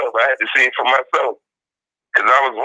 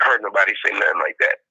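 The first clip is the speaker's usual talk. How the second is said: ordinary talk, nothing marked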